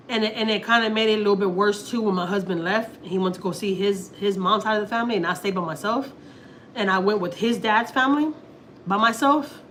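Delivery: ordinary talk, nothing marked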